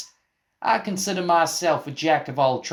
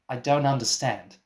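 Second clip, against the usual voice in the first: ordinary delivery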